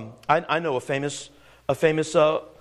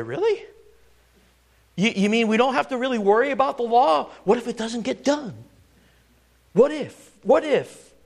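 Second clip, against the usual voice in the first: mock doubtful disbelief; 5 semitones higher than usual. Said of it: "We scratch our heads at that and say".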